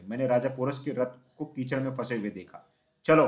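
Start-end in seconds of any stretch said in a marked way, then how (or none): 3.0-3.3 short, forceful narrated shout; punchy, controlled call to move; shouted command. A narrator's reading. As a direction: urge them on with a brief shout. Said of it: "porous चिल्लाया".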